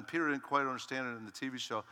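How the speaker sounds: normal talking voice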